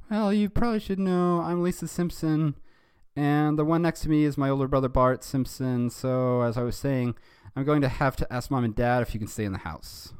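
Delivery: high cartoon girl explaining; Lisa Simpson. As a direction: introduce everyone in a high put-on voice. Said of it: "And Lisa said".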